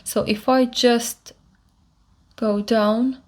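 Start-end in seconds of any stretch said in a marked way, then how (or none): none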